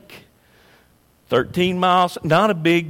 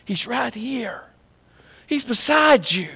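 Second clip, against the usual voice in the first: hushed, breathy whisper; 3 semitones higher, 3 dB quieter than usual. Real, not acted. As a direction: lean in and whisper it close. Preacher whispers God's nearness.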